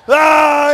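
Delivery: very loud, high, drawn-out yell; angry roar. Acted. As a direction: roar like an angry Jesus on a rampage. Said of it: "Jesus is angry".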